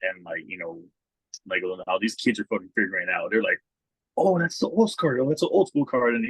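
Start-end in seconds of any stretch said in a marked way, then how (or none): 4.2-6.1 put-on teasing kid voice. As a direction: mimic the kids in a higher voice. Kids calling the card old.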